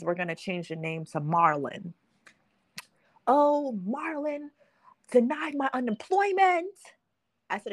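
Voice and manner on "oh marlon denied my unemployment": higher, upset whining; frantic friend. Retold or imitated. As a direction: play the friend in a panic. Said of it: "she calls me frantically".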